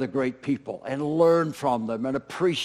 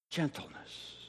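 whispered word; 16 dB quieter than usual. Shown as a demonstration. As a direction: whisper "Gentleness." softly with no voice.

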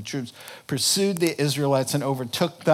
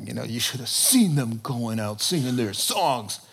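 usual speaking voice

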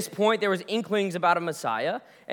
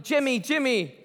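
calling out; 7 semitones higher than usual. Acted. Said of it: "You're yelling".

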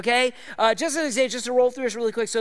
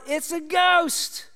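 slow, higher, drawn-out frightened cry; fearful cry; 9 semitones higher, 4 dB louder, much slower than usual. A narrator's reading. Disciples seeing Jesus on water, slow fearful cry. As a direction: read the disciples' terror into the word. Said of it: "In their fear, they cried out".

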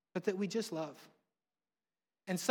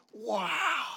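put-on child's wow; 8 semitones higher than usual. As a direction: call it out like an amazed kid. Daughter repeats from back seat.

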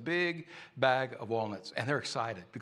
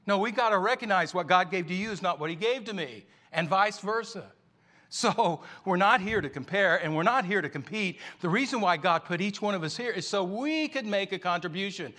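raised, louder preaching push; preaching at full force; 6 semitones higher, 5 dB louder than usual. Acted. Preacher urging the congregation, raised and louder. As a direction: drive the point home to the congregation.

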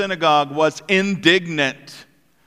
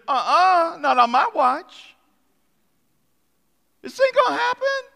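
much higher, fast, sassy refusal; sassy synagogue leader; 10 semitones higher than usual. Acted. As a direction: shut it down with attitude. Synagogue leader putting his foot down.

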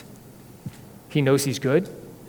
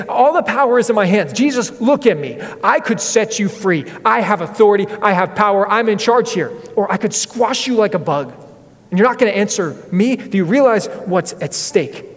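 higher, louder threatening boast; domineering Pilate; 5 semitones higher, 3 dB louder than usual. Retold or imitated. Pilate to silent Jesus, higher louder threatening. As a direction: lord your power over him.

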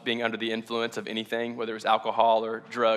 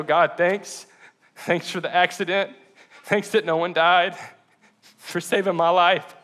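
tearful thanks; 6 semitones higher, 3 dB louder, slower than usual. Real, not acted. Preacher thanking God for saving his life, tearful and shaky. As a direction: voice raised and near breaking, slowing.

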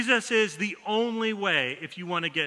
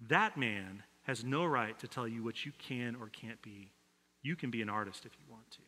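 low, soft and resolute; mother quietly reassuring her son he can be an artist; quiet firm mother; 7 semitones lower, 10 dB quieter than usual. Retold or imitated. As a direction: hold his gaze and say it gently but firmly. Mom grabs knee, speaks firmly.

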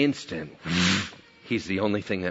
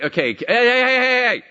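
rising, louder "hey" volley; calling to interrupt; 13 semitones higher, 7 dB louder than usual. Retold or imitated. God cutting off talkative Peter, insistent interrupting calls. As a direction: cut in over someone who keeps talking.

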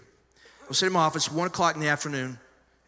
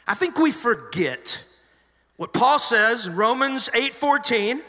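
higher and louder, driving each phrase of scripture home; full-force preaching; 8 semitones higher, 6 dB louder than usual. Acted. Pastor preaching Romans eight to the congregation, full force. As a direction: preach it to the back row with fire.

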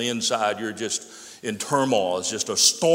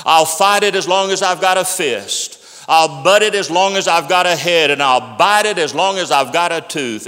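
higher, louder rallying vows; fervent preaching; 4 semitones higher, 5 dB louder than usual. Acted. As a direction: hammer each defiant line from the pulpit. Re-quotes evangelist with worry.

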